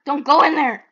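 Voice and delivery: urgent warning. Real, not acted. Warning friend from ghost room.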